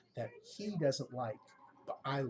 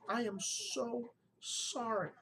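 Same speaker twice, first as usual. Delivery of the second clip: heartfelt apology; 6 semitones higher, slower than usual. Retold or imitated. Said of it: "And she says it again".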